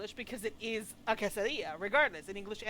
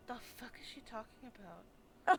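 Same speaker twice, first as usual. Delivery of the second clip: confused inner monologue; 5 semitones higher, 11 dB louder than usual. Retold or imitated. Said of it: "I'm literally sitting here going".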